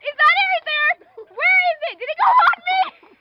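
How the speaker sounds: high startled shriek, sprayed with hairspray. Real, not acted. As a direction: yelp at the hairspray hit, then keep asking loudly. Don't spray me.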